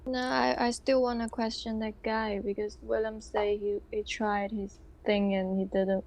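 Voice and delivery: ordinary delivery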